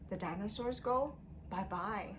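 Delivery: ordinary talk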